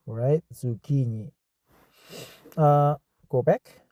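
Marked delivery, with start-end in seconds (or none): 0.5-1.3 "Zucchini" with a hard K, zoo-KEE-nee; corrected pronunciation. Shown as a demonstration. Learner copying the dictionary pronunciation, "Zucchini" said zoo-KEE-nee. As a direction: say "Zucchini" with K as in key. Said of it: "I heard about the pronunciation before".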